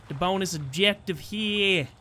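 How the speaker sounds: slow, drawn-out bad Brooklyn accent. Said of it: "I can't do a Brooklyn accent".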